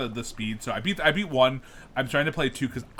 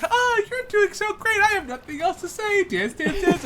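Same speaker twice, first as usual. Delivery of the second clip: cheery dancer voice; 4 dB louder than usual. Retold or imitated. Game dancer NPC quoted.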